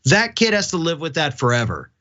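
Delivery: normal talking voice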